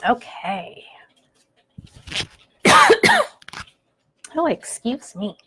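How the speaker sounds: coughing after choking on water. Real, not acted. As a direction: splutter through a cough, then recover. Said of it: "Choking on a little bit of water".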